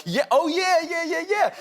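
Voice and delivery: high, loud, rapid-fire fake knowing; play-acted nervous eagerness, pitch way up; mock eager agreement. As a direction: bluff along with over-keen, high-pitched enthusiasm. Faking knowledge of helicopters to prince.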